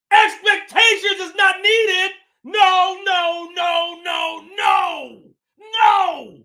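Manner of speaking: loud, high, repeated no; shouted refusal. Real, not acted. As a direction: refuse it hard, yelling. Rejecting expectations, shouting.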